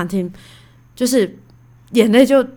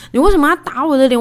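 faster, louder, higher indignant repeat; heated demand; 6 semitones higher, 5 dB louder, faster than usual. Retold or imitated. Wife repeating her demand to husband, faster and angrier. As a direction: fire the question back, quicker and hotter.

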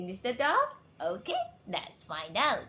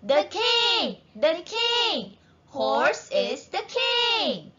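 high, cheering shouts for a king; crowd shout; 10 semitones higher, 3 dB louder than usual. A narrator's reading. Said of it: "Everyone shouts".

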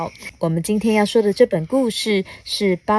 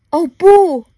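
ordinary delivery

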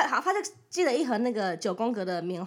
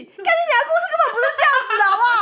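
high, loud, fast protest through laughter; furious laughing shout; 12 semitones higher, 10 dB louder, faster than usual. Real, not acted. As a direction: yell the correction, half laughing. 激动反驳别人的说法. (又气又笑地大声反驳)

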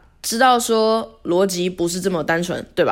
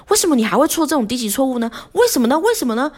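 mock nagging boss; 7 semitones higher, faster than usual. Mimicking boss nagging about mistakes.

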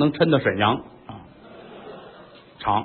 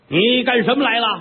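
stern empress; 7 semitones higher than usual. Acted. 丑娘娘质问妃子.

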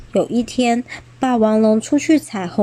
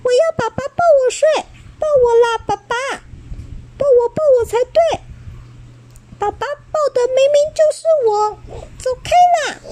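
put-on much higher, fast whiny child voices; baby dinosaurs fighting over who sleeps in dad's arms, whiny; squabbling babies; much faster than usual. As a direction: bicker like little kids fighting. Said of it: "甲龙宝宝吵了起来".

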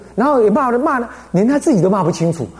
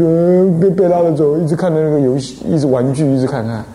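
normal talking voice

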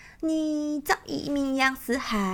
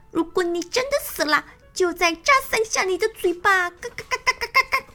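higher, fast, squawking bird voice; a sly hen cackling between words; 4 semitones higher, faster than usual. Acted. Lakanga teasing the fake-dead crocodile, squawking higher.

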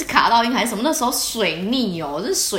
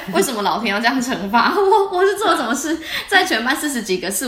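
laughing; 5 semitones higher, 3 dB louder than usual. Real, not acted. Mock lament, laughing.

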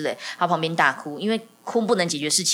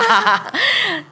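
trailing laugh; 5 dB louder than usual. Real, not acted.

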